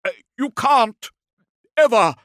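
high yelled fragments; desperate, faltering yell; incoherent defiance. A narrator's reading. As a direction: blurt out broken refusals at full volume. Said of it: "He descended into an incoherence of defiance".

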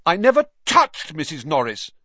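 put-on high, emphatic boy's protest; loud denial. A narrator's reading. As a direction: protest your innocence loudly. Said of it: "Harry said loudly".

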